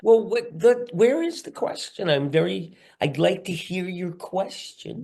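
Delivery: high-pitched fussy put-on voice; put-on questioning character. Acted impatience over the awaited question.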